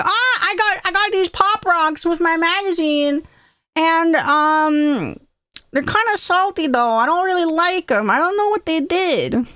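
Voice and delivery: put-on high-pitched, innocent child; little kid voice. Little Vanny explains Pop Rocks to brother, innocent kid.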